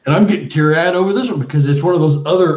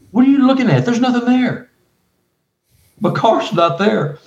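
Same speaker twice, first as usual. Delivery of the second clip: alarmed; 8 semitones higher than usual. Retold or imitated. Retold alarm, high.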